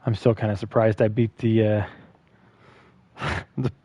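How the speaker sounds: usual speaking voice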